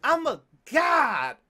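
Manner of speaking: slow, loud, high boast into a scream; triumphant shout. Real, not acted. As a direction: bellow it like a victor. Boasting after the streak.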